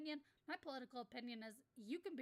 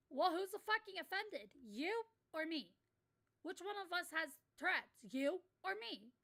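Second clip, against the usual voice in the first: louder, higher, "You or me" drawn out slowly; indignant retort; 5 semitones higher, 5 dB louder than usual. Retold or imitated. Ranting at people who correct him, indignant and loud. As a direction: fire it back at them, offended.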